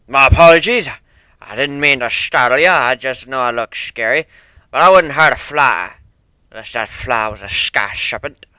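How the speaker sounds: put-on loud, stern old sailor with rolled R's; stern sea dog accent. One-winged Pegasus apologising to Fluttershy, stern thick accent. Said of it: "spoke with a stern voice, rolling R's in a thick accent".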